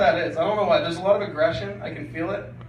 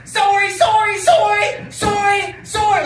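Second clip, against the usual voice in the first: much higher, louder sorry sorry; screaming apology; 7 dB louder, slower than usual. Retold or imitated. Woman in a car yells at the crossing guard, shrill screaming apology. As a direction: shriek the sorries at him. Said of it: "she's screaming at me".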